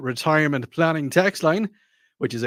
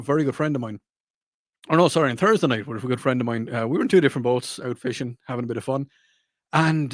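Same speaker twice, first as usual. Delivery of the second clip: ordinary delivery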